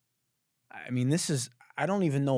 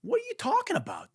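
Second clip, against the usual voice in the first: ordinary talk, nothing marked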